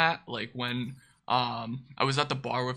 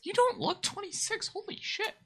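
much higher, stunned disbelief; astonished. Real, not acted. Telling Ziggy he looks young, high astonished disbelief. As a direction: gasp it out, floored. Shocked by friend's real age.

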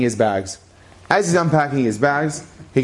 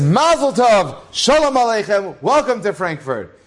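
effusive welcome; 7 semitones higher than usual. Retold or imitated. Wealthy man greeting the rabbi, a hammed-up gushing welcome. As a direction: gush over the new rabbi.

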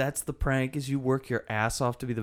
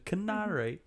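mock British "Canary", pitched up and soft; mock British accent; 6 semitones higher, 5 dB quieter than usual. Joking about perfecting a fake accent, mock British. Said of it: "perfect the way you say canary".

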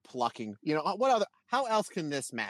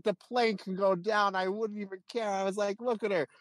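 higher, swooning fan praise laid on thick; mock gushing; 8 semitones higher than usual. Teasing his wife by raving about Tam, higher hammed-up swooning praise. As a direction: gush like a smitten fan to annoy her.

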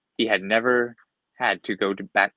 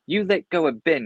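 yelled; 6 semitones higher, 3 dB louder than usual. A narrator's reading. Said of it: "he yelled".

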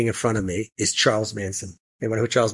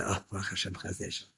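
whispered under the breath, much softer; relieved whisper; 4 semitones lower, 15 dB quieter than usual. Retold or imitated. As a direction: breathe a thankful whisper to yourself. Relief as the man is led away.

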